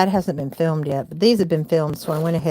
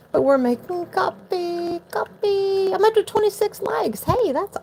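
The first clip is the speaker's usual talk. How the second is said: high-pitched; 12 semitones higher than usual. Real, not acted. Sudden high voice talking to viewers.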